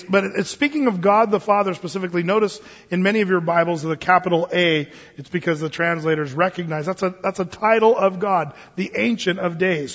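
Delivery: normal talking voice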